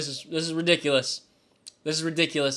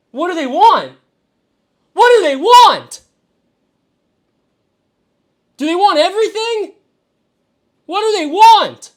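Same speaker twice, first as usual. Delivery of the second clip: exasperated yelling; 13 semitones higher, 12 dB louder than usual. Real, not acted. Furious at trade demands.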